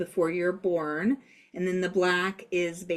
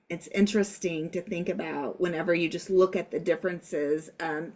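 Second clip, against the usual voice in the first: ordinary talk, nothing marked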